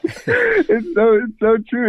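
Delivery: high, real laughing voice; laughing agreement. Real, not acted. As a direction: agree while still getting over a laugh. Agrees while laughing at the joke.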